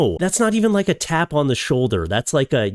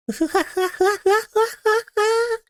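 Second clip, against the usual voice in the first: high squeaky fake laugh track; cartoonish canned laughter; mocking canned laugh. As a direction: imitate a sitcom laugh track, high and silly. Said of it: "Don't tell me when to laugh".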